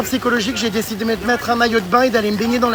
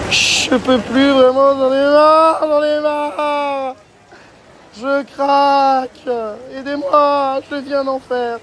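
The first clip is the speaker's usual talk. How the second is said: mock despair; 5 semitones higher, slower than usual. Mock breakdown, begging for help.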